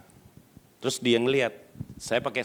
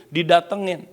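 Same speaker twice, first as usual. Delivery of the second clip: ordinary talk, nothing marked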